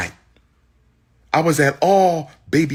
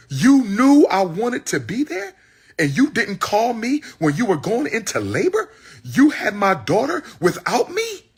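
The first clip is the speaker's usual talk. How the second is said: angry accusation; 10 semitones higher than usual. Retold or imitated. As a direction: confront her with hurt anger. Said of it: "Look how angry he is".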